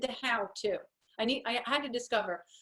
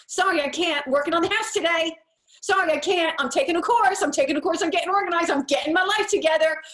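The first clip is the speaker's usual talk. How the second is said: higher and louder, a bright confident no; firm, energized refusal; 8 semitones higher, 9 dB louder than usual. Shown as a demonstration. To her sister, refusing with excited conviction. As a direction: turn down the sister with eager conviction. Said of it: "Do you see the different energy in there?".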